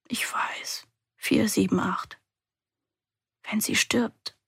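put-on a frightened girl whispering to herself; whispered. A narrator's reading. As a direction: hush it, almost no voice. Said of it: "flüstere ich".

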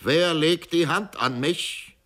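booming command, loud and high; thundering shout. Acted. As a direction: roar it as a threat. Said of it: "schrie mit donnernder Stimme".

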